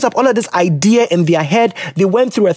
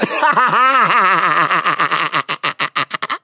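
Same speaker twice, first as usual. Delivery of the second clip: real belly laugh trailing on and on; helpless laughter. Real, not acted. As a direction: crack up and keep laughing. Cracks up at the letter.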